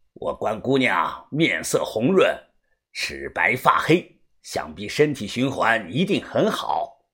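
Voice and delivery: very low, soft villain voice; reply with deep, polite relish; unruffled creepy master. A narrator's reading. Master courteously answers angry girl's insult, low creepy calm.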